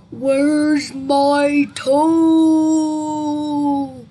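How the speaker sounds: loud groan, extremely drawn out; slow, booming haunted moan; groaning ghostly voice. A narrator's reading. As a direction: let the last word wail on and on. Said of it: "the voice groaned".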